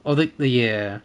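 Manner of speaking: ordinary talk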